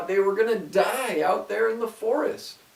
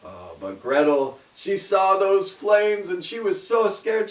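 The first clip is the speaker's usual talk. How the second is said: ordinary delivery, nothing marked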